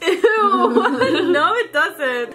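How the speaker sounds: laughing protest. Real, not acted. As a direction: laugh through a disgusted no. Laughing at gross comparison.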